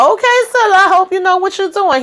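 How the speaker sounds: high skeptical caution; high-pitched warning. Retold or imitated. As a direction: squeak it out in disbelief. Narrator warning Priscilla.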